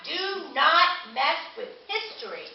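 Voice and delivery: normal talking voice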